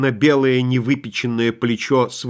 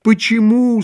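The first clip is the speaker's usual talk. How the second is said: bovine passion; 5 semitones higher than usual. A narrator's reading. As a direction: wail it like a lovesick cow. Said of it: "с коровьей страстностью".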